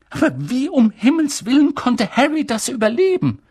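astonished stern professor demanding answers; agitated witch teacher. A narrator's reading. Professor marvelling at Harry's survival, high and excited. McGonagall voiced in audiobook.

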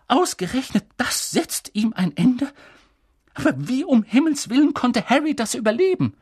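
high, loud, agitated professor; McGonagall, incredulous. Acted. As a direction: build to astonished, high-pitched disbelief. Narrator voices stunned, agitated McGonagall. (hohe, fassungslose Professorinnenstimme)

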